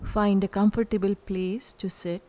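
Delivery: calm guided meditation with long pauses; unhurried, evenly spoken meditation instructions. Real, not acted. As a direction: lead them into stillness, leaving silence between phrases. Leads a breath meditation.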